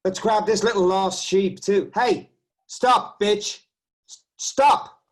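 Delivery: loud, high angry yell. Acted. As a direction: bark at her to stop. Riot cop grabbing a woman.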